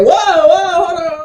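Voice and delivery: high, loud "whoa" stopping the rapper; imitating a host halting a rapper mid-verse; mock alarm. Host cuts off a rapper: high, loud mock alarm. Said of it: "He said".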